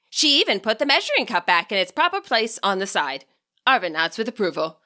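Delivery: ordinary delivery, nothing marked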